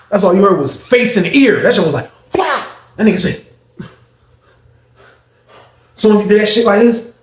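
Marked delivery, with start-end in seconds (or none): 2.3-2.6 high, sharp smack noise; mock punch sound. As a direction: spit out the slap sound.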